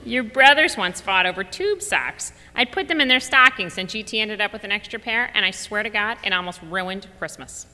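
a mother laying down the law on fairness; hammed-up emphatic grandmother; emphatic grandma. Mom giving advice by phone.

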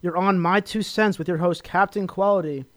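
loud, upbeat radio host greeting; radio host intro. Real, not acted. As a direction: open the show like a big-voiced DJ. Radio host opening the show.

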